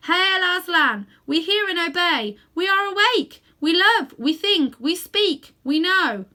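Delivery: loud, high chorus of creatures shouting; creatures hailing. A narrator's reading. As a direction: cry it out joyfully to the lion. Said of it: "in their different voices".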